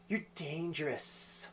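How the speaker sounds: slow, hushed, drawn-out melodrama; overacted Iceman. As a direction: lightly overact a softly spoken threat. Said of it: "it's, like, so overacted, dramatic".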